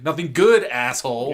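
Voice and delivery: loud, high snap at the fool; angry wizard. Retold or imitated. Wizard snapping at Kugel, loud and angry. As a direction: spit the insult out.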